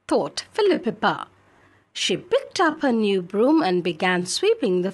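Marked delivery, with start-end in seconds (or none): none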